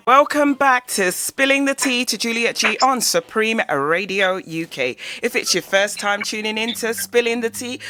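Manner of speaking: loud, upbeat radio intro; radio host. Acted. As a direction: welcome the listeners like a DJ. Radio show welcome announcement.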